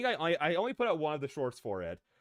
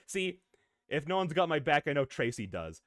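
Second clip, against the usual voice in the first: ordinary delivery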